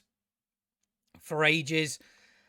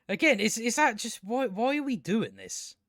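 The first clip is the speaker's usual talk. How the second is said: ordinary delivery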